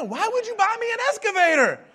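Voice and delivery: loud, high mock-angry wife; hammed-up outrage at the gift; mad wife. Wife angrily asks why she got an excavator, mock-furious yell. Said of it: "she was mad as fire".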